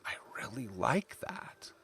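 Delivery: soft, slow near-whisper; imagined reader's whispered approval; hushed admiration. Retold or imitated. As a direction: whisper it slowly, as a reader quietly won over. Quotes an impressed reader.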